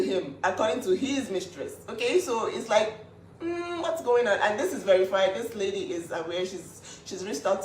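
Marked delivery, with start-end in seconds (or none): none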